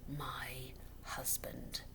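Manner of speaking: slow, icy whisper; widow's cold warning whisper. A narrator's reading. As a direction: hiss it with menace. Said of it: "she whispered, in a voice so cold".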